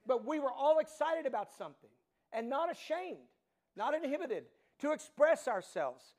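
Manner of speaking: high, loud, fired-up pulpit delivery; excited preaching. Acted. Pastor reliving the stadium joy for his congregation, excited and loud. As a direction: preach it like the crowd is still roaring.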